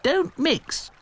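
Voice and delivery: lightly voiced strict doll; firm. A narrator's reading. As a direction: lay down the rules. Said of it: "said Dotty firmly".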